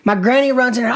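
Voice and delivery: ordinary talk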